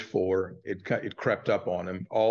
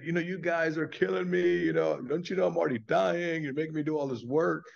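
higher, whiny complaining voice; mock whining; 6 semitones higher than usual. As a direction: whine like a worn-out patient. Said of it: "he would complain and whine".

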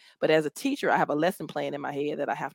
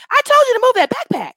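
much higher, louder frustrated yell; yelling at kids; 12 dB louder than usual. Retold or imitated. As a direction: scold the class at full volume. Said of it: "I'm already yelling when they come in".

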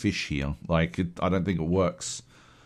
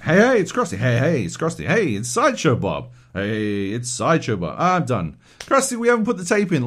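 hammed-up rushed line read; Krusty the Clown; 4 semitones higher, 5 dB louder than usual. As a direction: blast through lines like a clown. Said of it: "that's what it sounds like".

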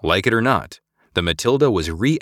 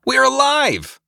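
much higher, loud joyful cry; cried out; 5 dB louder, slower than usual. A narrator's reading. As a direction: shout it in giddy relief. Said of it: "Eliza cried out".

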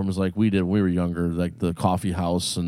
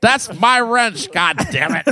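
higher, slow cranky geezer; old prospector; 11 semitones higher, 5 dB louder than usual. Pretend. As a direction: grumble like a crotchety old man. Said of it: "old man bit".

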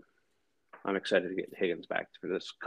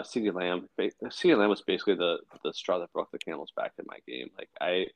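ordinary talk

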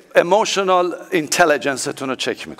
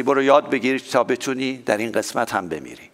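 ordinary talk, nothing marked

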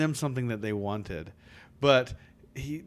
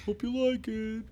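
high silly voice; 10 semitones higher, 3 dB quieter than usual. Pretend. Acting snow cone vendor.

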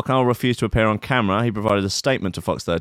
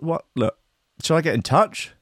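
usual speaking voice

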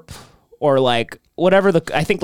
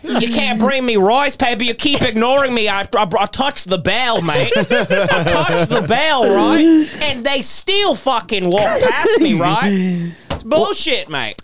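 laughing mock Aussie accent, higher; hammed-up Australian yob complaining about Korean barbecue; Australian bloke; 6 semitones higher than usual. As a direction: whinge about the restaurant service like an Aussie. Mock rant as the Australian man.

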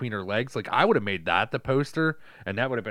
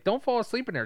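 high, lilting mother's call; mock nagging mom; 12 semitones higher than usual. Film mother calling to daughter in the tub, mock nagging. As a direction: imitate a fussing mom in a much higher voice.

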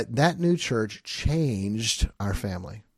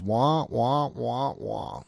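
muted trombone wah-wah imitation; Charlie Brown adult voice; much slower than usual. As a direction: mimic the droning teacher noise. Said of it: "They're just the Charlie Brown adults".

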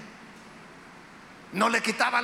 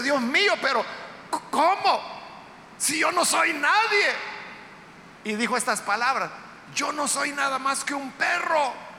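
shocked disbelief; 5 semitones higher than usual. Acted. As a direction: plead that it can't be you. Hazael protests in disbelief.

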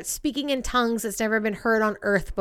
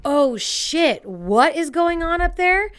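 raised, louder mock alarm; acted-out disbelief, pitch climbing; shocked reaction; 6 semitones higher, 4 dB louder, slower than usual. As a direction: react like a stunned viewer. Acts out a shocked viewer.

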